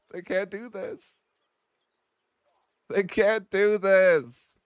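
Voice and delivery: high, slow despairing wail; drawn-out disbelieving lament. Real, not acted. Player mourning the lost dynasty, slow high wail.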